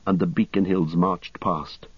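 usual speaking voice

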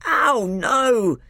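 high, pained, cross outcry; bellowing. A narrator's reading. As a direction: yelp it in protest. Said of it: "bellowed Trumpkin".

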